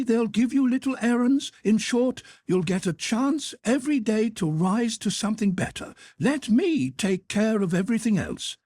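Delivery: high, brisk matronly hotel cook; head cook voice. Acted. Head cook offering Carl a job, high and bustling. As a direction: lift the pitch high for the kindly head cook.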